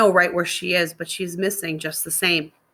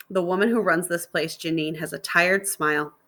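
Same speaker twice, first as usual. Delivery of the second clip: ordinary delivery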